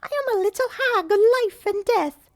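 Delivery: high, squeaky little hag; a tiny cackling crone. Acted. Another voice from the bag.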